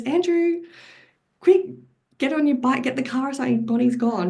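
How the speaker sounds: high, urgent call upstairs; reliving the moment she found her child missing; retold panic. Mother calls upstairs to Andrew that Bonnie's gone, panicked and high. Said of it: "went into panic mode".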